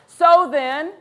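ordinary talk, nothing marked

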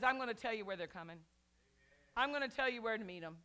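fast, low and assured; rapid God voice. Acted. Preacher voicing God to the church, fast and sure. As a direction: rattle off God's promises quickly.

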